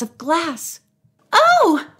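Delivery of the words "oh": loud, very high startled "oh"; startled cry. A narrator's reading. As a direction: cry out in alarm. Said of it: "the woman cried".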